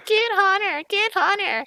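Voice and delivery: high-pitched imitation of a competitive girl's yell; yelling classmate. Classmate yelling at teammates in a competitive game, imitated high yell. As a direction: yell it loud and very high, mimicking her. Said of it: "she yelled".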